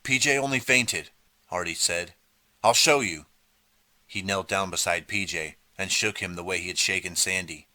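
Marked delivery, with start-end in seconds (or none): 0.0-3.2 high, louder dismissive tone; defiant dismissal; brushing it off. A narrator's reading. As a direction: wave off their fear. Artie dismisses the fear.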